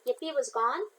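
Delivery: plain reading voice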